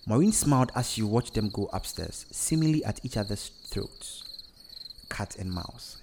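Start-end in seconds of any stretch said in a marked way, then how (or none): 5.1-6.0 low, soft aside; whispered. A narrator's reading. Mother watching bickering children go upstairs, whispering. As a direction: drop to a near whisper. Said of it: "she whispered".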